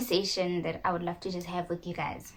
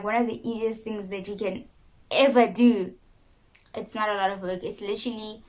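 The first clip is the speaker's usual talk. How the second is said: ordinary talk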